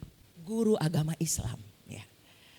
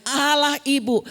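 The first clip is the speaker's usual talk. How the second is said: insistent teacher; 6 semitones higher, 7 dB louder, slower than usual. Retold or imitated. Teacher insists on his version.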